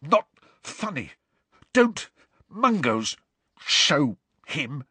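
high, broken, strained bursts; spluttering, half-throttled. A narrator's reading. Neville choked by Harry's hold, spluttering in fury. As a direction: splutter odd words through a squeezed throat. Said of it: "odd words spluttered from his mouth".

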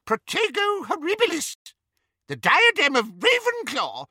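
high squeaky little wizard, startled. A narrator's reading. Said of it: "squeaked Flitwick".

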